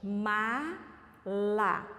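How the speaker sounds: "Mala" very slowly, a gap between the syllables. Shown as a demonstration. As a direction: stretch "Mala" into two separate beats. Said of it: "Vamos repetir pausadamente?".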